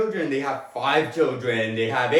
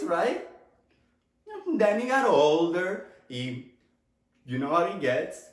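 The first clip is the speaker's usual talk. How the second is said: usual speaking voice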